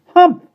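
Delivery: very high, loud bird's humph; scoffing humph. A narrator's reading. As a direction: scoff like an offended bird. Starling scoffs.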